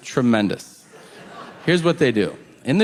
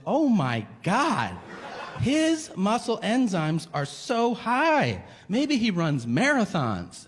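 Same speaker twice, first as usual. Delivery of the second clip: slow, swooping mock amazement; amazed nurse; 6 semitones higher, slower than usual. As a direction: marvel aloud, drawing out each phrase. Acts out nurse marveling at chart.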